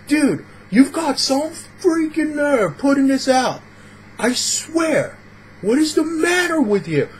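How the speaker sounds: high-pitched, drawn-out rant called across a room; hammed-up outraged heckling, pitch way up; acted angry yelling. Rude customer yells at vendor from twenty feet away, outraged. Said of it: "start yelling at him from".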